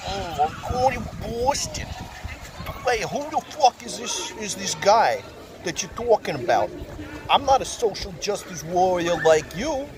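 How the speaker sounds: hammed-up Jersey mom accent, high and fast-talking; mom's Jersey accent. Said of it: "Your mom got more and more of a Jersey accent".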